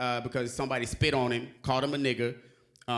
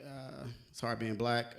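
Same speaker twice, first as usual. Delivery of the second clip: much softer, slow and heavy; quiet, slow aside; 5 semitones lower, 9 dB quieter than usual. Shown as a demonstration. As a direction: drop it to a tired murmur.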